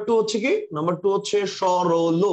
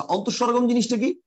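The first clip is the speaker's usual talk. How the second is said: ordinary talk, nothing marked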